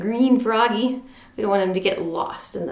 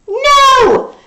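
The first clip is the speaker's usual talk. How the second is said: much higher, louder protesting no; crying out; 14 semitones higher, 11 dB louder than usual. A narrator's reading. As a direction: shout it as a defiant child. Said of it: "cried Froggy".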